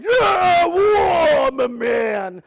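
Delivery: loud, high hammed-up victory cry; mock triumph. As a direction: pump your chest like a conquering hero. Triumphant after dive.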